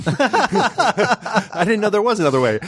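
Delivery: high, slow, wide-eyed innocence; naive husband. Retold or imitated. Husband innocently admitting ignorance to wife, slow high voice. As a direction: say it with dopey sincerity. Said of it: "And he goes".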